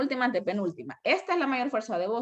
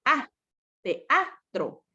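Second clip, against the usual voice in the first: "Ah" alone, then te-A-tro split; stress on A; much slower than usual. Shown as a demonstration. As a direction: punch the A in "teatro". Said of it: "la mayor fuerza de voz".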